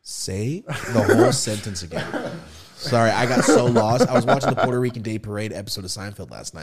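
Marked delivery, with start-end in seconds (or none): 0.0-4.1 slow words broken by real laughter; laughing request. Real, not acted. Lost listener asks storyteller to repeat, laughing. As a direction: crack up while asking for a repeat. Cracks up after losing the thread.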